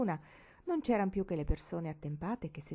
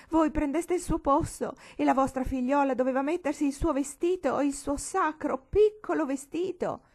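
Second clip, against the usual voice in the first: much higher, louder, frantic wail; hysterical outburst; 7 semitones higher, 7 dB louder than usual. Acted. Old lady refusing to calm down, hysterical shouting wail. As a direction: fling the words back in hysterics.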